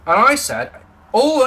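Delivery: normal talking voice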